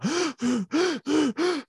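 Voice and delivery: imitated high, hitching gasps for air; sobbing gasps. Weeping client unable to breathe before counselor, imitated sobbing gasps. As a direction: gulp air in rapid, panicky heaves. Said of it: "mind you, at this point, I'm".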